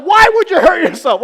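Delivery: loud, high hammed-up scolding; dad playfully shouting at daughter; mock shout. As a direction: yell it in fake outrage.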